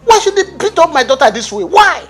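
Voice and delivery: high, loud indignant demand; enraged father demanding his daughter back; outraged. Acted. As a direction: demand answers, rising in fury.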